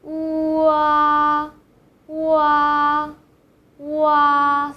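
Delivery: "Wa" as u plus ah glided fast; joined wa. Shown as a demonstration. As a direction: glide from u straight into ah. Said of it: "join the syllable together".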